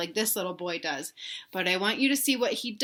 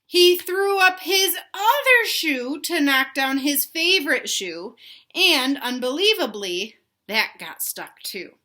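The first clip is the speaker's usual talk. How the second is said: slow, higher and louder storybook build; reading to children; 6 semitones higher, 7 dB louder than usual. A narrator's reading. Teacher reading Stuck to young kids, big storybook voice. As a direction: lift the line up high to make it dramatic. Teacher reads picture book aloud.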